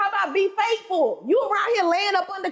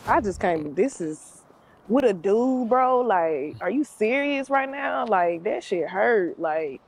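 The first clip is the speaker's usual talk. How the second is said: hurt and deflated; 9 semitones lower, slower than usual. Acted. Hurt girlfriend after catching boyfriend.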